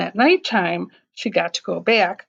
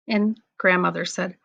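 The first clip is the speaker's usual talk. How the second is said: usual speaking voice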